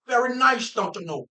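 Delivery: hammed-up Minnesota accent, starting high. Minnesotan showing off the niceness, Minnesota accent. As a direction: play a chirpy Minnesota accent local. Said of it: "Minnesota accent".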